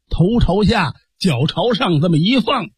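plain reading voice

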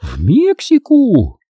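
high, long-drawn echo of disbelief; astonished chorus cry. A narrator's reading. Passengers echo the new destination in chorus, astonished. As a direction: stretch it out high in shock. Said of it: "Снова выступление хором".

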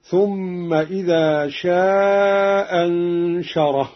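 slow recitation, شاء stretched long straight into أنشره; first hamza dropped, elongated. Shown as a demonstration. Teacher shows the madd option, long vowel without first hamza. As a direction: stretch the aa of شاء, only one hamza after it. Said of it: "والمد هكذا".

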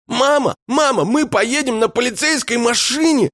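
put-on very high, shouted child's glee; squeaky-high jubilant kid; excited little boy. A narrator's reading. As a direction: call out to mum, thrilled. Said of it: "захлопал в ладоши мальчуган".

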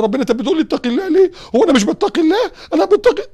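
rising indignant outcry; upset protest. Retold or imitated. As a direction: protest hurt and offended, climbing. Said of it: "زعل النبي وقال له".

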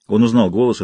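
usual speaking voice

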